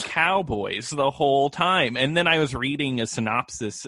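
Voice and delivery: usual speaking voice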